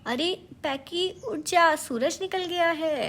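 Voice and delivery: high, loud, eager call to wake a friend; excited wake-up call. A narrator's reading. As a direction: shake him awake with cheerful energy. Jackie wakes sleeping Packie. (जोश भरी ऊँची पुकार)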